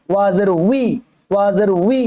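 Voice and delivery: "উই" as wi, starting on rounded lips; rounded w onset. Shown as a demonstration. Teacher shows the correct waw, clear wi sound. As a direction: glide from w into a long i on "উই". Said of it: "উচ্চারণ ঠিক করবেন".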